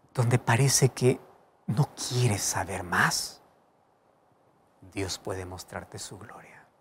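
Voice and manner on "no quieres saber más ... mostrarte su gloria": slow, soft whisper; hushed preaching. Real, not acted. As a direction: drop to a slow whisper for hope. Preacher's climax on despair. (predicación en susurro lento)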